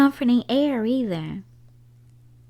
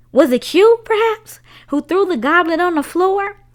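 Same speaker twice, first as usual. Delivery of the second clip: teasing question; 9 semitones higher, 9 dB louder than usual. Acted. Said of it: "he asked her".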